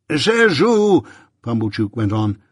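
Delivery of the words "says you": lightly voiced pompous tradesman; self-important, preachy and grand; pompous old man voice. A narrator's reading. Pumblechook speaks pompously.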